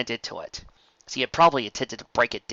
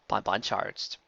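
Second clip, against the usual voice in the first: ordinary delivery, nothing marked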